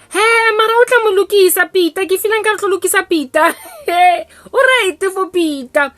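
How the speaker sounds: high, loud cooing mother; mother picks the girl for her son Peter, high and loud; gushing matchmaker mom. Retold or imitated. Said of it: "mother insists again".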